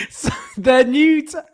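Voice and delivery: high, slow words breaking into laughter; cracking up. Real, not acted. Host trying to explain the new tack, cracking up. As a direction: try to talk through real laughter. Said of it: "you shouldn't laugh".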